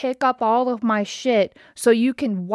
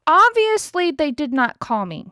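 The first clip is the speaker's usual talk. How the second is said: higher-pitched, pointed comeback; exasperated retort; 6 semitones higher than usual. Retold or imitated. Customer answering the service man about a call, exasperated retort. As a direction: throw it back with pointed exasperation.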